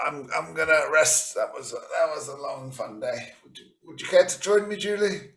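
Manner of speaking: high, loud, drowsy pirate charm; Blackbeard's invitation. Acted. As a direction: woo her with a big sleepy grin. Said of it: "and he says".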